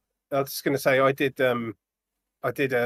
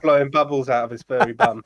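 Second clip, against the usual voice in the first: laughing tease; 3 semitones higher, 5 dB louder than usual. Real, not acted. Teasing Paul's avatar, then laughing.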